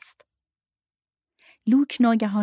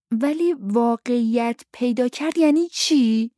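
put-on higher-pitched child pressing the question; insistent child; 6 semitones higher, 4 dB louder than usual. A narrator's reading. Luke presses the grown-ups for an answer, insistent. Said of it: "لوک اصرار کرد".

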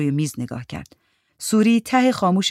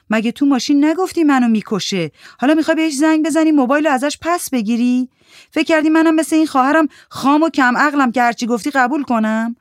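higher, louder, scornful accusation; young woman mocking a liar who begged a ride, raised and scornful; angry confrontation; 9 semitones higher, 5 dB louder than usual. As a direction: throw the scorn right in her face. Suri confronts Mandana angrily.

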